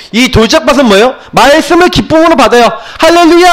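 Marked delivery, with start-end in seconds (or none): 3.0-3.5 joyful hallelujah. Retold or imitated. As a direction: burst out like someone thrilled by the word. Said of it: "하고". (기뻐 외치는 할렐루야 흉내)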